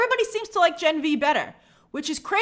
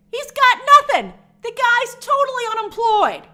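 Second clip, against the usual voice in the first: high, loud "he's got nothing"; exasperated outburst; 10 semitones higher, 7 dB louder than usual. Real, not acted. Ranting to viewers that the writer is free, high and loud. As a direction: throw your hands up in disbelief.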